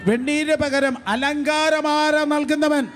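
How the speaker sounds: fervent revival preaching, rising to the top; full-force preaching. Acted. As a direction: preach it out over the crowd with fire.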